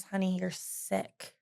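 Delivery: soft, slow, tender mother; hushed loving diagnosis from mom; gentle mom. Retold or imitated. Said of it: "she goes".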